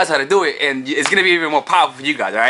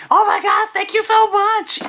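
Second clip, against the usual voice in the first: much higher, swooning thank-you; flattered girl; slower than usual. Acted. Woman thanked for a compliment, high gushing voice.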